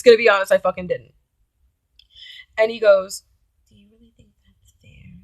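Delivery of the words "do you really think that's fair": hushed reproachful question; quiet guilt-tripping boyfriend; whispered guilt trip. Retold or imitated. Boyfriend quoted.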